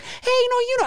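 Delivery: high squeaky mock greeting to a dog; doggy greeting voice. Owner greeting a sniffing dog, squeaky high. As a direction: pitch it way up like greeting a pet.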